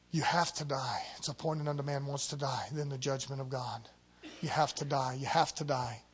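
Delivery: low, very soft and grave; solemn hushed preaching. Real, not acted. Preacher telling the church they must die to self, hushed and solemn. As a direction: drop right down and let it sink in.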